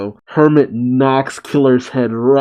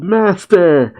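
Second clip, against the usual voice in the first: high-pitched acted cry; small character's anguished call; 6 semitones higher, 3 dB louder than usual. A narrator's reading. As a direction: call out in a higher voice. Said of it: "Pino cries out".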